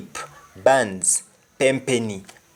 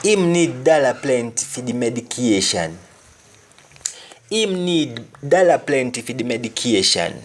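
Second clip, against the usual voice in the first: Jamaican patois. Shown as a demonstration. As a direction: demo sentence in broad Jamaican patois. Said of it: "He needs plenty of money".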